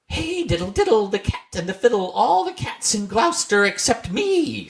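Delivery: high, thin cat voice; Simpkin the cat. A narrator's reading. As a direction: sing it out like a wistful cat. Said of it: "said Simpkin".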